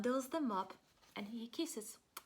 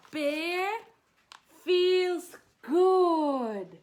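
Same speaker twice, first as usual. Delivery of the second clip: louder and higher, bright good; joyful burst; 7 semitones higher, 13 dB louder, much slower than usual. A narrator's reading. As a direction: let feels good burst out. Bear wakes up well.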